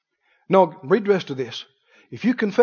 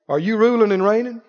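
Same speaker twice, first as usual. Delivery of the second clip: ordinary talk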